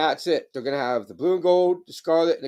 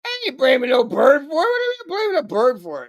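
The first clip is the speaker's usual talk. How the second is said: higher, louder squawky protest; high indignant parrot voice; 11 semitones higher, 3 dB louder than usual. Acted. Bird voice protesting.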